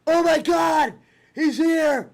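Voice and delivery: high, shouted announcer call; wrestling commentator. Pretend. Mimicking announcer's surprise entrance call.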